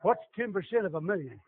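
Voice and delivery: ordinary talk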